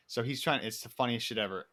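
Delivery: ordinary talk, nothing marked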